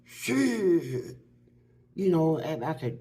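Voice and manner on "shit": higher, mock hollered curse; a raised, half-performed cry of frustration; retold holler. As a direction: imitate him cursing out, pitched up. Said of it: "Then he'll holler".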